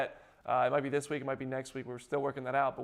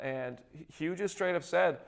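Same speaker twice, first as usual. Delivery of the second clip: ordinary delivery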